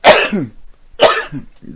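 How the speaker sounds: loud sudden sneeze; sneezing. Real, not acted. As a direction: let out a big real sneeze. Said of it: "Attention, je vais éternuer".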